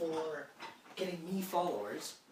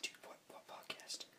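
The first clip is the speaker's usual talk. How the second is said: whisper; 17 dB quieter than usual. Real, not acted. Whispered surprise.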